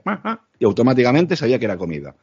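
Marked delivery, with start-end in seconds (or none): 0.0-0.4 very high, softer cat reply; imitated cat. Cat looking at owner asking for food, imitated high cry. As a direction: answer like a cat, high and small. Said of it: "me miraba y me decía".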